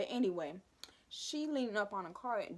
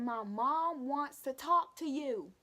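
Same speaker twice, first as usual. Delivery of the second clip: retold shout; 6 semitones higher, 4 dB louder, slower than usual. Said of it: "I think I shouted at her".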